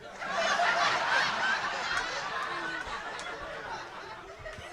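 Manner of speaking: high unvoiced hissing snicker; breathy laugh. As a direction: snicker like a whole class laughing. Mimics class reacting.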